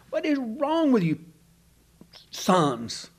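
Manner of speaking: high, pleading disbelief at his sons; exasperated father. Retold or imitated. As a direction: Jacob scolding his careless sons, exasperated. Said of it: "He's just exasperated".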